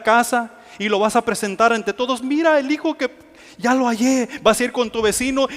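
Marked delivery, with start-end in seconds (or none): 2.2-4.3 higher, proud and overjoyed; joyful announcement. Retold or imitated. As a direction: show the child off to everyone. Parent showing off found child. (anuncio alegre, voz más aguda)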